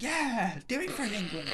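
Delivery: high-pitched mock rallying cry; weird Little Britain voice. Podcaster cheering along with the film's fight scene, high mock cry. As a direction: echo the hero's cry in a silly high voice. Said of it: "weird little Britain voice".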